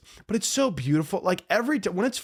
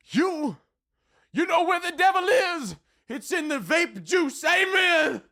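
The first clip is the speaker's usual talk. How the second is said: high, fired-up Southern preacher voice; hammed-up Southern preacher sermon. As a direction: preach it like a hellfire Southern pastor ending on a shouted amen. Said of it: "I got into my Southern preacher mode".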